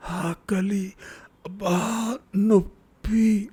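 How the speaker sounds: high, pained groaning words, the last one slowly drawn out; moaning in pain. A narrator's reading. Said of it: "మూలుగుతున్నాడతను".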